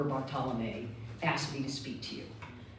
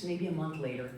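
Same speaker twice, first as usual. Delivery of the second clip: normal talking voice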